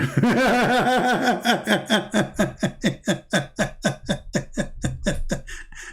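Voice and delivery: a long real burst of laughter. Real, not acted. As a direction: laugh it off, amused at the insult. Laughs at voice changer accusation.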